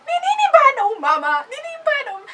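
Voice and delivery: high, loud exasperated wail; pissed-off outburst. Acted. Child fuming inwardly at mother's slaps, high loud outburst. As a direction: cry out in acted outrage, pitch way up. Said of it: "I'm so pissed off".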